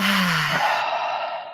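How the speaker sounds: long, airy exhaled "haaa"; breathing out. Shown as a demonstration. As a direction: let the whole breath out in a slow sigh. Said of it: "and then breathe out".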